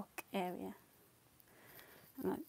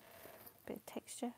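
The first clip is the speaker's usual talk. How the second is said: slow, softer whispered phrase; hushed slow aside; 4 semitones higher, 12 dB quieter, slower than usual. Real, not acted. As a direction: draw it out in a whisper. Whispered word while painting.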